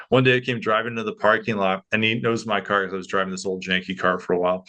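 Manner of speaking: usual speaking voice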